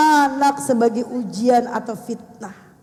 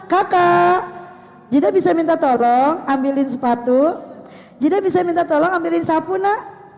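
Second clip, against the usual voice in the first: sweet polite request; 5 semitones higher, 4 dB louder than usual. Retold or imitated. Grandma asking grandchild politely.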